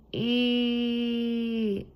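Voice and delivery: long, high held squeal on one note; drawn-out "eee". Real, not acted. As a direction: hold a high "eee" while waiting. Noise while computer is frozen.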